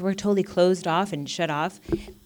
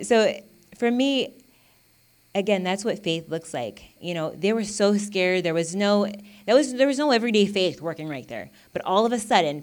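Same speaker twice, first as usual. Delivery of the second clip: usual speaking voice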